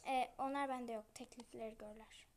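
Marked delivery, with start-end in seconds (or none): 1.1-2.3 whispering. Real, not acted. Whispering while playing game.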